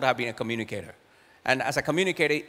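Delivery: ordinary talk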